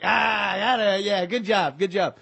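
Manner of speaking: loud, high mock cheer; mock hearty approval. West Side guy praising J J's silence, hearty shout. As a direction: holler it like a guy well satisfied.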